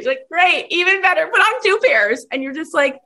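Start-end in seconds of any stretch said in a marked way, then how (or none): 0.3-2.3 high, gleeful imitation of a founder on a roll; higher, eager sales zeal; gung-ho founder. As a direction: push it with unstoppable mock enthusiasm. Founder undeterred, pushing her product.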